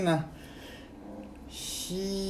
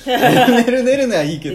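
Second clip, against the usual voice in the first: high, loud laughing words; 10 semitones higher, 14 dB louder than usual. Real, not acted. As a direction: crack up mid-sentence. Laughing at own failed play.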